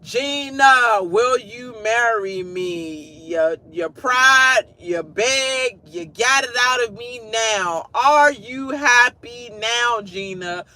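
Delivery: slow, drawn-out mock plea; hammed-up Martin begging. Imitating Martin begging Gina to marry him, slow and hammed-up. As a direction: beg dramatically, stretching each word. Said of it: "remind me of the Martin show".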